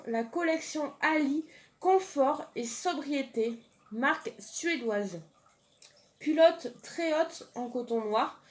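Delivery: ordinary delivery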